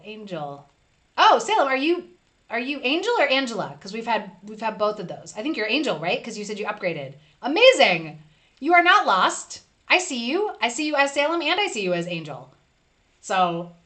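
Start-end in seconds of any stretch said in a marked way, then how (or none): 1.2-3.8 loud, high, delighted hello; light up at seeing a regular; excited call-out to a chat friend; excited greeting. Real, not acted. 7.4-12.5 loud, high and beaming; excited reassurance to a viewer. Real, not acted. Reassuring lost viewer brightly.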